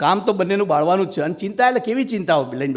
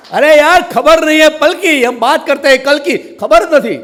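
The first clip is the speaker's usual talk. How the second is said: loud exclamation; 8 semitones higher, 10 dB louder than usual. Real, not acted. Exclaims with a Hindi line.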